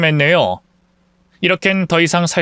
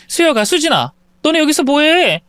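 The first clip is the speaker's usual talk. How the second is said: alarmed call; 11 semitones higher than usual. Acted. Mother finds kids hiding, alarmed.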